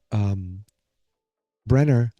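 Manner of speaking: ordinary delivery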